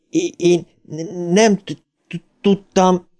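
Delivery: slow, high, badly stuttering denial; anguished stammer. A narrator's reading. Bill defends himself to dead George, slow high stutter. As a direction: choke on each word, pitched high.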